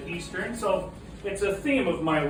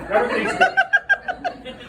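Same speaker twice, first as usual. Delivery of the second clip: laughing; 3 dB louder, slower than usual. Real, not acted. Joke, laughing at own line.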